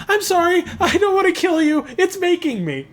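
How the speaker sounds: high-pitched, pitiful robot voice; cutesily depressing robot. Pretend. Reluctant killer robot apologising to its victim, cutesily depressing. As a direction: put on a cute, sad little robot voice. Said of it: "like, cutesily depressing".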